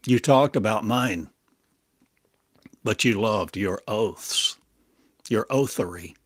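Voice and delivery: ordinary talk, nothing marked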